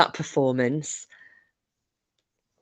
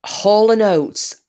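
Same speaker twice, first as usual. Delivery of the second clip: slow, stretched out and a bit louder; drawn-out name; 4 semitones higher, 4 dB louder, much slower than usual. Real, not acted. Reads out a song request.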